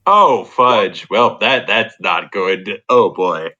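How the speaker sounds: normal talking voice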